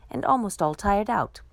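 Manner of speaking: plain reading voice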